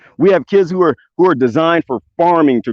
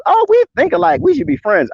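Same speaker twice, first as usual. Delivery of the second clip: mocking online friends; 11 semitones higher than usual. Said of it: "make friends with people who think like you do".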